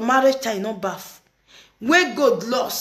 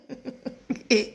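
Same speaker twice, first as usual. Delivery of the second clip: soft, breathy chuckle without words; helpless little laugh; 5 dB quieter than usual. Real, not acted. Amused by the other woman's video, laughing.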